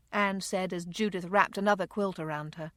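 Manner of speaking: ordinary delivery, nothing marked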